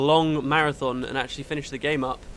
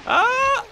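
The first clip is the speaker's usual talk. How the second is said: very high, loud yelp; sudden high cry of alarm; startled yelp; 6 dB louder than usual. Real, not acted. Startled during the game fight.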